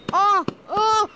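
loud, high yelps of fright; startled yelps. Real, not acted. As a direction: jump at the sudden bang. Fireworks startle her.

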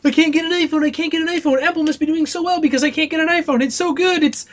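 high, fast mock fanboy whine; gushing Apple fanboy. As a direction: parody a fanboy who can't get his phone. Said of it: "people run around all the place".

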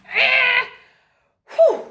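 high, loud blown-out breath; relieved puff. Acted. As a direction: exhale in relief after the ride. Giraf relieved after braking.

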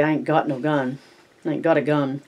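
usual speaking voice

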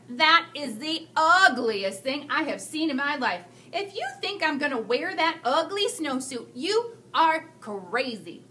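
put-on slow, scornful little boy refusing the snowsuit; a stubborn child dragging out his disgust; defiant kid. Thomas sneering at his new snowsuit, slow and scornful. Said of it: "he said".

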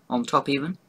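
talking while chewing; mouth full of cake. Real, not acted. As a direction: between bites of pastry. Seen 3: Bites the cream-filled cake repeatedly and chews while saying the words.

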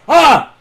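sudden high shriek of fright; short panicked cry; startled scream. Real, not acted. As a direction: yelp as something jumps out. Startled by game monster.

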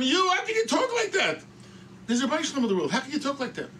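high-pitched protest, shouted then easing off; indignant rebuke. Retold or imitated. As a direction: act out a scandalised scolding. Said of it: "jumps up".

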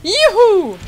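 real excited yelp; gleeful cheer. Real, not acted. Happy to play the game again.

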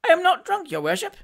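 plaintive denial, starting very high; squeaky, wronged objection; high-pitched protest. Acted. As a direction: protest innocence to the magistrate. Accused man protests to magistrate.

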